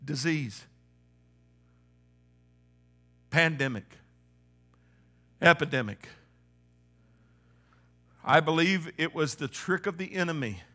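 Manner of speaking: soft, low, intimate sermon voice over music; hushed preaching. Acted. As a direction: bring it down and speak close to the heart.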